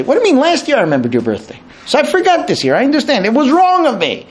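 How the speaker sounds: high, rushed husband protesting; defensive husband. Retold or imitated. Husband answers birthday complaint, high and defensive. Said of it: "You never remember my birthday".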